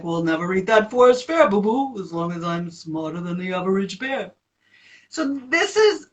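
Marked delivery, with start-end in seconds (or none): 0.0-4.3 low chest and throat resonance cartoon bear; low dark resonant cartoon bear. Shown as a demonstration. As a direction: drop into a dark throaty Yogi-style voice. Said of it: "So I can do that right now".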